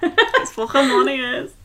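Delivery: put-on quick throwaway Scouse accent. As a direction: drop into a Scouse accent for one line. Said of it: "I do that in a Scouse accent".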